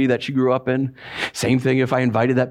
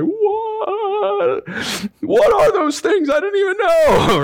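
high, loud squeal of disbelief; shocked church kid; 3 dB louder, much slower than usual. Retold or imitated. Youth pastor mocking the sheltered church kids, squealing in shock. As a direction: play the naive kid who can't believe it.

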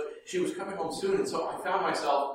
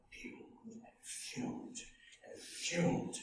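whispered; 5 semitones higher, 14 dB quieter, much slower than usual. A narrator's reading. Describing father's silent anger.

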